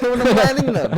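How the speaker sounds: real laugh through high, loud words; laughing exasperation. Real, not acted. Retelling a crash to a friend, laughing in exasperation. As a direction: laugh helplessly while protesting, pitch climbing.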